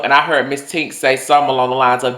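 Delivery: usual speaking voice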